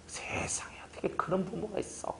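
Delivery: breathy conspiratorial whisper of disbelief; whispered outrage. Retold or imitated. Pastor siding with a congregant, whispering shock. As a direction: hush it like sharing a scandal. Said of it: "한술 더 떠서 얘기해 주거든요".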